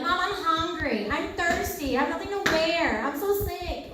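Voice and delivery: high-pitched imitation of a complaining kid; needy child. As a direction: pester mom with a list of complaints. Voices children nagging their moms.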